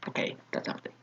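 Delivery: breathy whisper, a private aside; whispered to himself. Real, not acted. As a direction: drop to a whisper for the throwaway comment. Aside after reading a joke.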